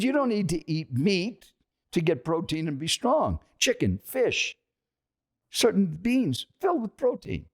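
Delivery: high-pitched, emphatic explaining; imitated preachy insistence, pitch held up; retold insistent lecture. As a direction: lecture them like a pushy stranger. Acted stranger lecturing him.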